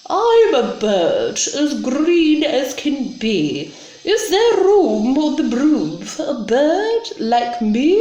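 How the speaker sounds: high, lilting green bird; chirpy bird voice. Acted. Bird asks the witch for room on the broom, high lilting voice. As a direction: play a bird politely asking, pitch swooping up.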